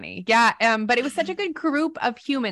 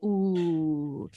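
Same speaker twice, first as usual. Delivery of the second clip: low drawn teasing ooh; a knowing ooh; mock ooh; 3 semitones lower, 4 dB quieter than usual. Friend reacting to advice given, knowing mock ooh. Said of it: "I'm like".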